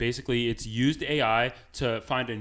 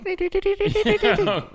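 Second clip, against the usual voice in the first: high-pitched alien noise; 7 dB louder than usual. Pretend. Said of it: "I think it would be more high pitched".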